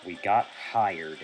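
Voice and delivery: normal talking voice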